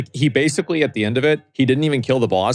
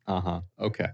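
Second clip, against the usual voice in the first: low, soft, unbothered reply; dismissive; 4 semitones lower, 8 dB quieter than usual. Retold or imitated. Replying to somebody rude or obnoxious, quietly dismissive. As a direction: brush them off quietly, pitched down.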